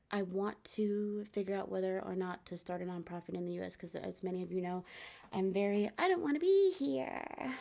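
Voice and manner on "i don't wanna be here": higher, louder, put-on protest; mock reluctant whine. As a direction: whine it like a stubborn kid. Voicing her own reluctance.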